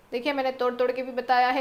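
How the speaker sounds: ordinary talk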